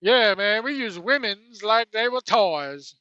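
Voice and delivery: mushy mumble in a put-on voice; Tony Atlas mumbling impression. Host mocks Atlas's mumbled talk about women in a put-on voice. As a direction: talk like marbles in the mouth. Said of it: "talks like he's got marbles in it".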